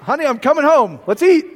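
hammed-up loud, high homecoming yell; cheerful call home. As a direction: call out to the wife, done with fasting. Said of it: "And I going".

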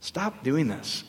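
soft, slowed, pleading aside; gently begging them to stop, almost under breath. Retold or imitated. Speaker pleading with strict Christians, soft and slow. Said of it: "I'm like".

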